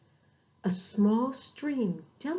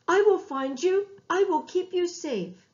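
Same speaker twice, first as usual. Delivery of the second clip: calling out; 8 semitones higher, 4 dB louder than usual. A narrator's reading. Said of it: "the shepherd called out".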